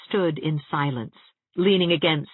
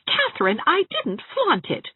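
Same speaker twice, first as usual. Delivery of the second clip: indignant. Acted. Anne protesting.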